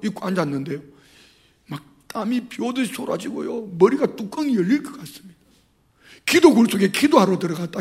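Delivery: ordinary talk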